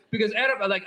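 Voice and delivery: ordinary delivery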